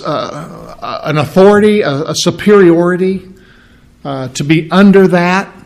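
ordinary talk

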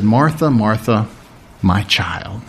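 soft, low, tender address; hushed, fatherly tenderness; very loving. Acted. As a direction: speak gently as to your child. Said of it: "and yet very loving".

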